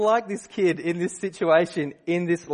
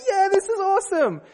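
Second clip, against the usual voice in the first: put-on much higher, loud kid's whoop; whoop of joy; 4 dB louder than usual. Optimistic son whoops with joy in the manure shed. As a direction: squeal it like a kid having the time of his life. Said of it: "whoops of joy".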